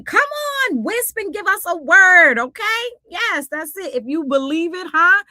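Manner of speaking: loud, high call-and-response hype; mock church exhortation. Host hypes Wisp's word on believing, mock preaching. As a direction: cheer it on like a fired-up congregation.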